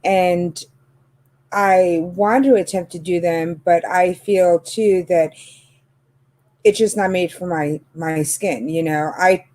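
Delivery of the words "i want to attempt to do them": ordinary talk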